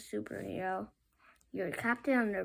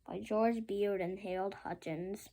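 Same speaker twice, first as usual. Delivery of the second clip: ordinary delivery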